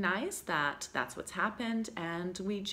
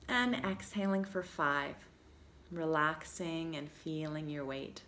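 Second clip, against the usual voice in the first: guided meditation. Acted. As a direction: guide them through each breath unhurriedly, with long pauses. Leads guided breathing relaxation.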